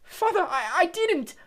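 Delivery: high, loud panicked shriek; a terrified boy begging his father. Acted. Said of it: "shrieked the boy".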